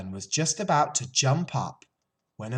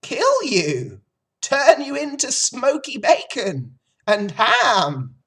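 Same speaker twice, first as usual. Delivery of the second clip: put-on loud, high old sheep spelling out doom; 14 semitones higher, 11 dB louder than usual. A narrator's reading. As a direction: relish the grim news as the old sheep.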